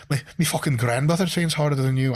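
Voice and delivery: high, loose mock Scottish accent; boastful taunt in a would-be Scottish accent. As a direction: put on a hammed-up Scottish accent. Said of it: "I know that's a Scottish accent".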